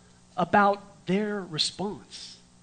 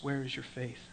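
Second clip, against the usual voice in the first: softer, lower, gentle question; a soft lower Jesus voice; hushed; 7 semitones lower, 15 dB quieter than usual. Acted. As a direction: ask it quietly, almost tender.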